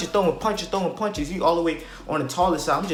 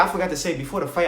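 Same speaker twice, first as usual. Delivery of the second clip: ordinary delivery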